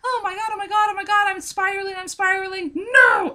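high, fast panicked spiral rising to a loud no; hammed-up heroine losing it; spiraling heroine in a mock panic. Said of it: "two paragraphs of her going".

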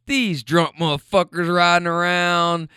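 ordinary talk, nothing marked

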